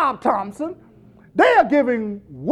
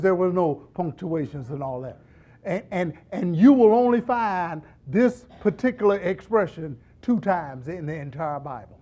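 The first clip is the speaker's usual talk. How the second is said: ordinary talk, nothing marked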